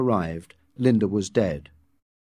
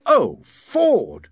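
high, loud groan of alarm; dismayed; 9 semitones higher, 6 dB louder than usual. A narrator's reading. Deputy director spotting trouble, dismayed. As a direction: exclaim in dismay.

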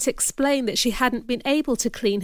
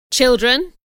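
loud call for attention; stern address; 3 semitones higher, 6 dB louder than usual. Acted. Man calling the children to attention, loud and stern. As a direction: address the whole room forcefully.

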